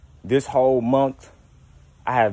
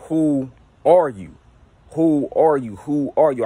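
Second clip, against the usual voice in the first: slow, higher, louder and intent, asking the mirror; slow insistent question at his own reflection; slow probing question; 4 semitones higher, 4 dB louder, slower than usual. Retold or imitated. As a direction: stare into the mirror and demand who's there. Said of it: "And then I started saying".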